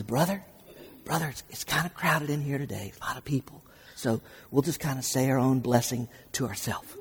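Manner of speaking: low, hushed confiding voice; soft imitation of a discreet churchgoer; discreet murmur. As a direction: murmur it privately to the man beside you. Retold discreet excuse to avoid praying.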